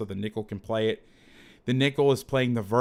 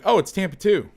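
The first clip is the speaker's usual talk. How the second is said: high, loud burst of mistaken recognition; mock eureka; 9 semitones higher, 8 dB louder than usual. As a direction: blurt it out like a sudden discovery, pitched way up. Mimics his own mistaken snap judgement.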